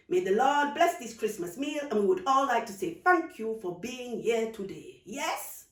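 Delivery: saying grace. Acted. Aunt says grace.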